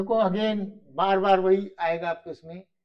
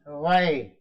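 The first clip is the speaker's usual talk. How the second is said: ordinary delivery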